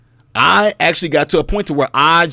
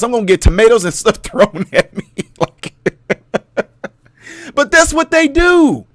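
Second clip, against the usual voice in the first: laughing; 4 semitones higher than usual. Real, not acted. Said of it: "laughing at own joke".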